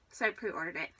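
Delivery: usual speaking voice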